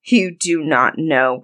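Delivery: slow, measured menace from an accusing husband; menacing Bluebeard. A narrator's reading. Husband confronting his wife about the key, slow menace. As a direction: draw out the accusation slowly.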